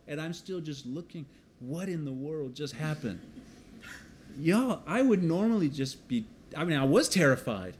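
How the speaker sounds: usual speaking voice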